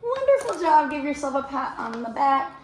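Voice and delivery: high, loud cheer of praise; delighted praise. Real, not acted. Teacher congratulates young readers after reading, delighted. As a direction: praise them in a high, excited voice.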